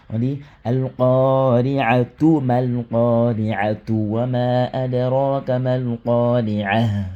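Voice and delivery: slow recitation linking "al-qari'atu" through; continuing recitation. Shown as a demonstration. Teacher recites the joined verse for students, slow. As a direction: read on without stopping, slowly. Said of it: "lorsqu'on veut passer".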